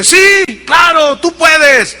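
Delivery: high excited shout; eager cheer. Acted. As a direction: egg him on. Spectator cheers.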